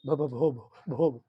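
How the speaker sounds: stuttering over the first syllable; stutter, stuck on the sound; mock child's stammer. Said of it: "ऐसे बोल रही है".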